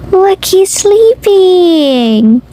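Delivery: plain reading voice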